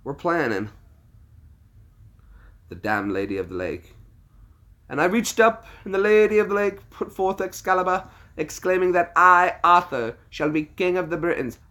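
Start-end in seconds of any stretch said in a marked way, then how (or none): 4.9-11.6 loud, high British declamation; high, forceful British oration; British film recitation. Acted. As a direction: proclaim it in a grand British accent. Reciting Monty Python's King Arthur scene.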